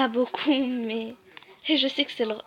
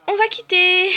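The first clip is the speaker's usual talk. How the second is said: loud exit; 7 semitones higher, 8 dB louder than usual. Real, not acted. Quitting the boring game.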